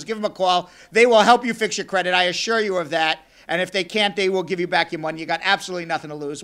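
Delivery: upbeat ad read; radio ad read. Acted. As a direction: read the sponsor plug with energy. Host repeats sponsor ad.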